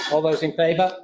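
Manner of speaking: ordinary talk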